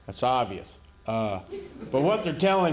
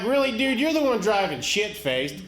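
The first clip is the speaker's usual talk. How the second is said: incredulous retort; 6 semitones higher than usual. Retold or imitated. Retold retort to the ticketing deputy.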